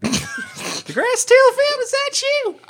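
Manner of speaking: high, drawn-out elf greeting; calling out high. Acted. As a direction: call out across the tavern in a lilting high voice. Elf NPC calls out in character.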